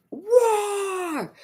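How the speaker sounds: high, drawn-out roar; mock enraged roar. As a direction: imitate Dracula losing it. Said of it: "He's mad. He's going off".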